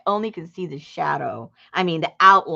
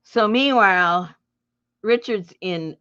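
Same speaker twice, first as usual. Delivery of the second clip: ordinary talk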